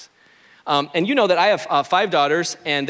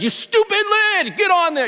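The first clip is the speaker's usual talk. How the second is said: high mock shriek of a frustrated little girl; hammed-up kid yelling at a cup lid; mock yelling child; 14 semitones higher than usual. Pastor imitating his daughter yelling at a stuck lid, in a high mock shriek. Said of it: "They're yelling at the inanimate object".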